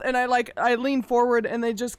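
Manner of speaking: usual speaking voice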